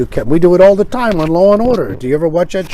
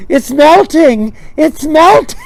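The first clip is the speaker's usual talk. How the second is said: high-pitched hammed-up alarm; mock panic; 9 semitones higher, 5 dB louder, much slower than usual. Objector alarmed that Alaska is melting, mock panic. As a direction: wail it like a frantic complainer.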